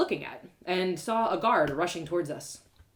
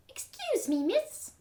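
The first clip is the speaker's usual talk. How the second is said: put-on much higher little boy voice; a timid child calling out; small boy; slower than usual. Ragged beggar boy calls to narrator, timid small voice.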